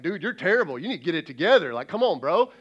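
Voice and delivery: mock scolding buddy. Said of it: "I'm like".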